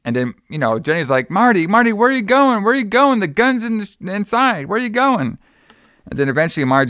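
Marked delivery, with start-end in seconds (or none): 1.3-5.4 frantic calling. Retold or imitated. Jenny calling after Marty as he walks off, high and frantic. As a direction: shout after him in rising panic, voice pitched way up.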